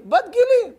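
put-on high tearful boy's reply; crying teen. The dumped teen telling the rabbi her age, high and tearful. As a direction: choke it out like a heartbroken kid.

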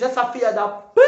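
retold angry curse as he storms off. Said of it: "sai com raiva e diz".

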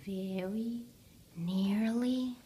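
hushed, rising to hopeful; soothe, then brighten; soft bedtime. A narrator's reading. Bedtime hush, rising end.

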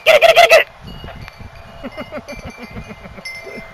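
high excited repeated cheer; yelling from the sidelines. Real, not acted. Cheering dog on to fetch.

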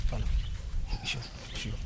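panting, breathless whisper; out of breath. Acted. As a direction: gasp the words out. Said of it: "why are you panting like that".

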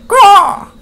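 very high loud bird caw; raven caw. A narrator's reading. Hungry raven frightening the monsters, sharp loud caw. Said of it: "flaps the hungry bird".

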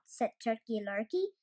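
usual speaking voice

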